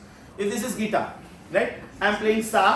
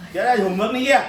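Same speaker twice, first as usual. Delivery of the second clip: louder, scolding rise on किया, half laughing; teacher's mock scolding; 2 semitones higher, 5 dB louder, much faster than usual. Shown as a demonstration. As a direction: tell off a kid who skipped homework. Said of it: "tone change हो गया".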